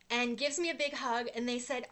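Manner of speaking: usual speaking voice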